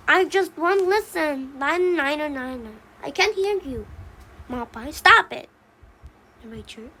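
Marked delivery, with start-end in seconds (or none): 0.1-3.9 put-on defiant child. Baby Pine refuses to listen to Papa, defiant. 4.9-5.5 louder, higher parental command; sharp scolding. Acted. Mama Pine stops Baby Pine's chanting, scolding loudly. Mother scolds child's tantrum.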